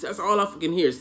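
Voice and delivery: usual speaking voice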